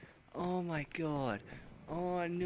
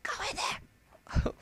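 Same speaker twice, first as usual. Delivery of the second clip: high, breathless whispered plea; scared whisper; frightened whisper; 4 dB louder, much slower than usual. Real, not acted. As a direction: whisper it, terrified. Scared urging at the screen.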